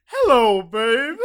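hammed-up giggly hello; cartoon catchphrase. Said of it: "I remember what he says".

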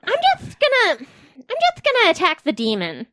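panicked character. Acted. Emmy deciding in panic.

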